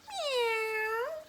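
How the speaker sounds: high drawn-out meow; cat's meow. Shown as a demonstration. Storyteller showing children what a cat says, high meow. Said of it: "what does a cat say".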